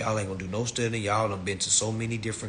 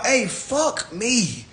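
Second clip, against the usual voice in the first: mock plea; 11 semitones higher, 5 dB louder, much slower than usual. Mock plea to ignore him.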